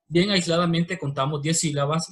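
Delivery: normal talking voice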